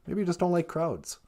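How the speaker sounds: low, soft suggestion; the partner suggests a dislike of crowds, low and soft. Pretend. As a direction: gently tease back. Partner voice reply.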